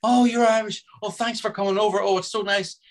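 mock the actress gushing over a fellow Irishman; imitating an overly warm new friend; gushing welcome. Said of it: "She goes".

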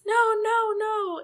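high, loud, panicked cries; mock panicked no. Retelling the character's fear as people approach, high mock panic. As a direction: squeal out the denial in mock alarm.

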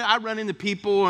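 normal talking voice

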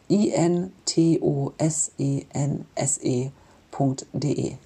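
slow, letter by letter; spelling it out. Shown as a demonstration. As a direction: spell each letter clearly and slowly. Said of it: "ich buchstabiere es mal".

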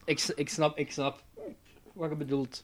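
hiccuping between words; talking through hiccups. Real, not acted. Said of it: "ik heb, eh, de hik".

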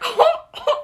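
laugh. Real, not acted. Laughing while retelling.

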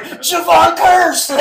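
high, loud, slow triumphant yell with a laugh; yelling a hero's name. Retold or imitated. After beating the tackle, yelling his idol's name loudly. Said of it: "Did you just yell Javon Curse?".

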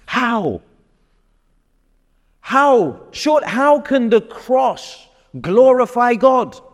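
rhetorical outcry. Acted.

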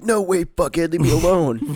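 mock kid retort. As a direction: play a bratty kid snapping back. Kid voice in retold schoolyard.

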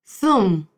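"Zoom" with the z as th; Castilian theta. Shown as a demonstration. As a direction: say "Zoom" as thoom, like Spain. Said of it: "Habla nuevamente".

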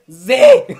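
very loud, high outburst; incredulous shout. Real, not acted. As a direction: shriek the letter in mock outrage. Zomba da letra escolhida. (grito de indignação)

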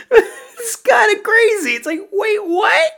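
very high, squeaky real laughter through the words; laughing incredulously. Real, not acted. Film fan reacting to a crazy scene, laughing. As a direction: crack up while saying it in disbelief.

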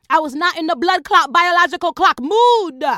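loud, high outburst; exasperated shout. Acted. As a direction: yell the refusal at full volume. Blown-up repeat of the refusal.

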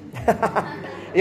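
soft unvoiced chuckle; a quick airy laugh; breathy laugh. Real, not acted. Laughing at his joke.

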